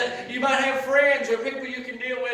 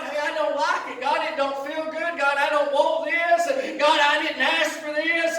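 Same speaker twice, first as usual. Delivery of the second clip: raised, insistent preacher's complaint; griping to God; 4 semitones higher, 6 dB louder than usual. Acted. Preacher acting out a believer's gripe session with God, griping. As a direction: air each grievance to God, building louder. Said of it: "go to God with a gripe session".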